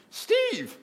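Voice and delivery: put-on high, loud call of his name like an elderly Scottish woman; elderly Scottish lady calling Steve; old Scottish lady. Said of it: "she talked like this".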